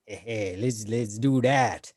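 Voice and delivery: normal talking voice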